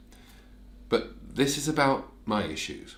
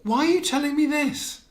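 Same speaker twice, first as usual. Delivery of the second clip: high, loud, fast exasperation; exasperated shout; 12 semitones higher, 8 dB louder than usual. Acted. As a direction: shout it in disbelief. Exasperated outburst at therapist.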